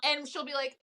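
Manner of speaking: usual speaking voice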